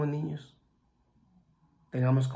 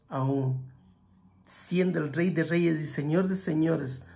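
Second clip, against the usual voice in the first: usual speaking voice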